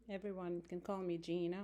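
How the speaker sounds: normal talking voice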